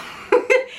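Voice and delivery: short high breathy laugh through tears; teary laugh. Real, not acted. Mother laughs away tears while telling her birth story, breathy and high.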